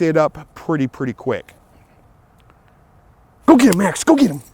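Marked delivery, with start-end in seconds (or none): 3.5-4.4 higher, louder, excited release; sending the dog off. Real, not acted. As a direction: fire the dog up to run.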